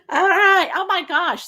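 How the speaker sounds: loud, high burst of joy; thrilled host reacting to the draw; delighted cheer. Real, not acted. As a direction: react with real delight to the win. Won the drawing, delighted.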